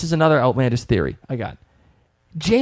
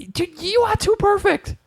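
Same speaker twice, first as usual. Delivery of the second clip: mock swoon. Mocking Jamie's perfect image.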